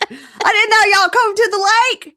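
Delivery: high, loud party bro yelling from a boat; hollering hey like a party guy. Retold or imitated. Friend imitating a partying guy shouting from his boat.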